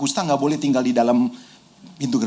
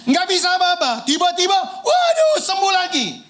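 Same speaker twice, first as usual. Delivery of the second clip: excited shout; 10 semitones higher than usual. Acted. Preacher acting out healed lepers' joy.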